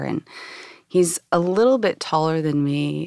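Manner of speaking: ordinary talk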